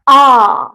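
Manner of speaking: आ sung out loud with the mouth wide open; open vowel demo. Shown as a demonstration. Teacher showing students the open vowel, loud full आ. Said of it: "आ का उच्चारण करते समय हमारा मुख द्वार".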